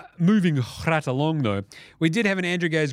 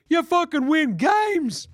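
hyped shout; 13 semitones higher, 4 dB louder, slower than usual. Real, not acted. Hyped punchline.

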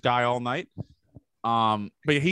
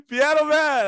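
high, slow, shouted bar request; 6 dB louder, slower than usual. Retold or imitated. Yelling at the jukebox guy at the bar, loud slow shout. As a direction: belt it across the bar like a movie scene. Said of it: "I'm doing the fucking Catalina Wine Mixer scene".

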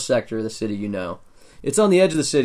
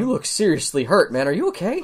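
high dwarf clerk voice; 6 semitones higher than usual. Acted. Dwarf clerk reacts to injuries.

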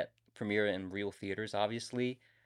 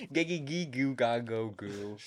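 silly babbling voice; 4 dB quieter, slower than usual. Retold or imitated. Mimicking Chicken Boy's babble.

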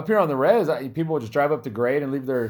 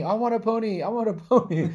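put-on high whining little girl; whiny kid voice; 8 semitones higher than usual. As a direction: beg for a pony like a child. Child begging for pony.

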